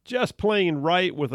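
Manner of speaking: punchy station ID over music, stretching the slogan; radio announcer read. Acted. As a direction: sell the show name like a jingle. Station sign-off and ID.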